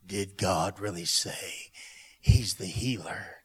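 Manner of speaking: low, slow, sinister insinuation; voice of the enemy. Acted. Enemy trying to speak to the believer, low and insinuating. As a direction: plant doubt with a very low, dragging question. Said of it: "the voice of the enemy is trying to speak".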